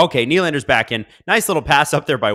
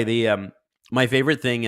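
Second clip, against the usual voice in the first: usual speaking voice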